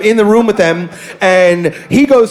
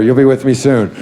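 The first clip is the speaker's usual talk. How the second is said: mock ominous repeat; 7 semitones lower than usual. Repeats line lower, ominous.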